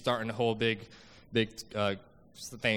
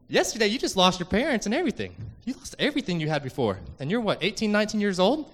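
higher and louder, building with wonder; amazed; 8 semitones higher, 4 dB louder than usual. Real, not acted. Telling the host about the convert, rising amazement. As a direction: marvel at how much he lost and gained. Marvels at convert's new life.

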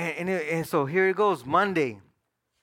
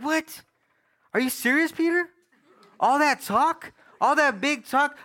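higher-pitched, incredulous challenge; angry disbelief; 7 semitones higher, slower than usual. Retold or imitated. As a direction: call Peter out in retold outrage. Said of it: "I went to work angry".

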